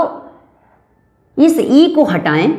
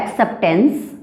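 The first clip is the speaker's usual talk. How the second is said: Acceptance with the last syllable lifting upward; careful demo of acceptance, tune climbing at the end; rising sound; 4 dB quieter than usual. Shown as a demonstration. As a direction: let the end of acceptance rise. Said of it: "थोड़ी सी ऊपर की ओर उठ रही है".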